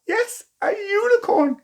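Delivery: "Yes, a unicorn" squealed high with delight; delighted wonder. A narrator's reading. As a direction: gush over it like a thrilled child.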